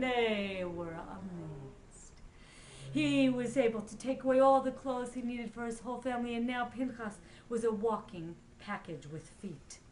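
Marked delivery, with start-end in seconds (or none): none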